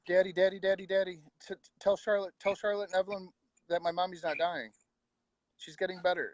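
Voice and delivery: put-on high, pleading child's voice, stammering in urgency; imitating upset son. Son runs downstairs to Dad about his mom, imitated high pleading. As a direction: mimic a little boy begging his dad. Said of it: "he comes flying down my stairs going".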